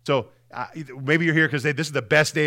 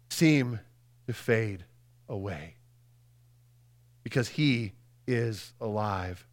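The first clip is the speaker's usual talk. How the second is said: dropping from full voice to near silence, slowing; fading to soft; 4 semitones lower, 7 dB quieter, slower than usual. Acted. Preacher describing fears fading, voice dropping to a hush. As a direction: let the words fade away.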